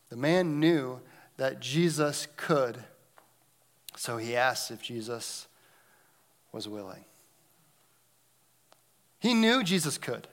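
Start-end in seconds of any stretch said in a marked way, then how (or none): none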